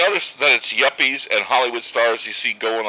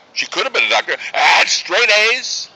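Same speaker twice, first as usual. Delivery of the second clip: frustrated protest; 6 semitones higher, 5 dB louder than usual. Acted. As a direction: build louder and higher in parental frustration. Father protesting daughter's wasted potential.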